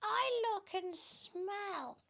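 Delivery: very high, slow, preening voice; high, silly show-off voice; vain Aunt Sponge. Acted.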